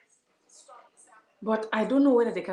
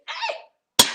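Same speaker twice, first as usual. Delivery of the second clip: high, loud laughing cry of surprise; startled laughing yelp over spilled tea; 9 dB louder than usual. Real, not acted. Laughs while spilling her tea.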